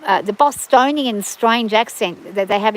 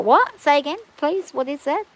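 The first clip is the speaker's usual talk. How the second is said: baffled asking; 4 semitones higher than usual. Acted. Listener lost by thick New England accents, baffled asking. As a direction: act out not catching what was said. Acting out failing to understand accents.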